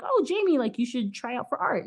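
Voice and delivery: high-pitched teacher impression; mock art teacher. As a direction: playfully imitate the teacher.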